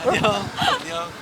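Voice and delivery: high, slow sing-song farewell; excited goodbye. Real, not acted. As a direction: stretch out a bright bye-bye. Waving goodbye to passing crew.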